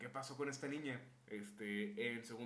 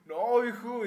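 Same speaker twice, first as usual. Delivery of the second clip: mock loud refusal; 8 semitones higher, 12 dB louder, much slower than usual.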